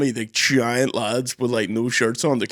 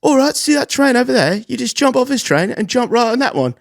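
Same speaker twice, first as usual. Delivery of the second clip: mock conductor woman; 12 semitones higher, 5 dB louder than usual. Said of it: "the woman was like".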